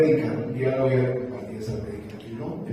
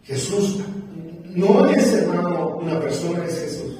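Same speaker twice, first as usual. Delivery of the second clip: a touch of preacher's fervor on Jesús; emphatic preaching; 4 semitones higher, 4 dB louder than usual. Acted. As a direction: build and land on Jesús. (énfasis de predicador)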